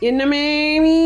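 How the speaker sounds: drawn-out teasing மேமி in place of மாமி; sarcastic, cheeky tone; teasing mock address. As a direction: twist மாமி into மேமி to needle the mother-in-law. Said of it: "கிண்டல் பத்தியா உனக்கு?".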